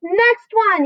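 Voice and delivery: high, loud call; shouting. A narrator's reading. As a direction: call out the next one. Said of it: "he shouted".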